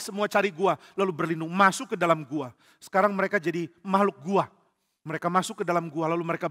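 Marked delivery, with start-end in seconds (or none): none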